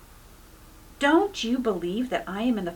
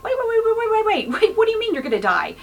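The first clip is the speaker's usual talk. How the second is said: much higher, rushed repeated waits; panicked stammer; 11 semitones higher, 4 dB louder, faster than usual. Retold or imitated. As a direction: stop him short in alarm, speeding up. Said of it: "He was panicking".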